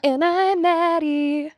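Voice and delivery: high, slow dramatic intro; mock dramatic.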